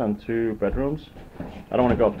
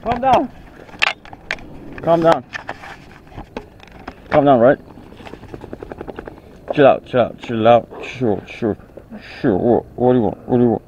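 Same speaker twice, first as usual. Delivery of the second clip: firm calming; 8 dB louder than usual. Real, not acted. Settling excited dog.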